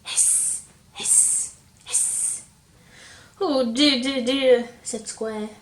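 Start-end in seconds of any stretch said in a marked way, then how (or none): 0.0-2.4 long unvoiced snake hiss. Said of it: "just like a snake". 3.4-4.7 louder fretting moan; a frightened square wringing its hands; mock worry. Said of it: "was afraid of snakes".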